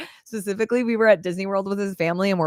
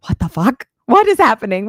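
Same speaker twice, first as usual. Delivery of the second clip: loud, high stunned cry; shocked outburst; 4 semitones higher, 8 dB louder than usual. Retold or imitated. Hearing he was never deployed, loud shocked outburst. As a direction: blurt it out in shock. Learning he lied.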